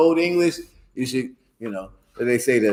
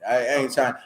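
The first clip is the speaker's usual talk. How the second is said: usual speaking voice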